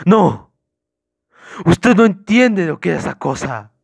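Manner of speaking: loud, high hysterical outburst. Acted. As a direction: burst out in drawn-out, frantic protest. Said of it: "dijo histérico".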